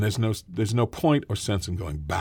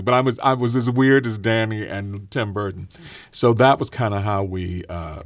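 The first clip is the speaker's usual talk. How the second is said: ordinary talk, nothing marked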